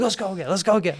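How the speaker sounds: high, agitated mock demand; retold pissed off. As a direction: imitate an angry sore loser insisting. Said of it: "He was so pissed off".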